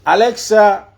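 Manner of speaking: loud, high voice command; calling out. Real, not acted. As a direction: project it at the voice assistant. Repeating the voice command.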